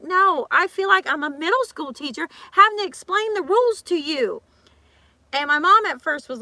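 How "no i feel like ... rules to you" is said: high and loud exasperated comeback; retold retort. [name] answering the gym member, loud and high. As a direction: push back hard, voice up high. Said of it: "I'm like".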